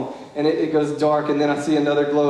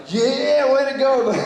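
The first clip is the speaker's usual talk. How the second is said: high, louder mock hype; mock bro cheer; 9 semitones higher, 3 dB louder, slower than usual. Boy in back seat expecting cheering, mock hype. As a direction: cheer like a buddy egging him on. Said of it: "he's looking for me to be like".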